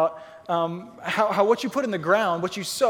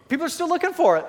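excited; 8 semitones higher, 4 dB louder, faster than usual. Real, not acted. Preacher stressing the point.

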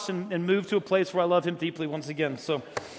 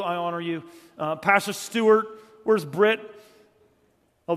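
usual speaking voice

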